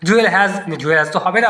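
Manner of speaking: usual speaking voice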